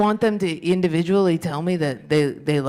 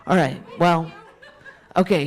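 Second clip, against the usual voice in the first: ordinary talk, nothing marked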